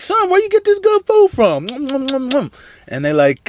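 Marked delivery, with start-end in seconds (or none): 0.0-1.7 much higher, squeaky mock parent voice; comic high-pitched dad asking his son; amazed parent. Parents voiced tasting the honey. 1.7-2.5 eating noises. Retold or imitated. Parents eating the honey.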